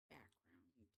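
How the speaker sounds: breathy trailing whisper. Real, not acted. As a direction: let it fade to a whisper.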